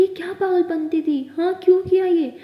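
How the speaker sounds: loud, high angry outburst; frightened scolding. Acted. Aliya scolds Maya after saving her, shouting in fright.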